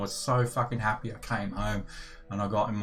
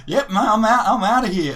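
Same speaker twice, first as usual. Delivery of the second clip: cocky friend; 11 semitones higher, 8 dB louder than usual. Retold or imitated. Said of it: "And he's like".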